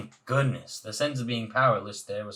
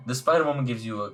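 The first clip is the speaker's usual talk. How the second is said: plain reading voice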